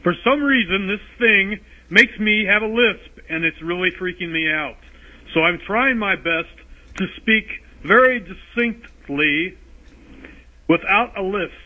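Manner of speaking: over-enunciate like a radio host to hide a lisp. Real, not acted. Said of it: "I'm going to have to use my radio voice".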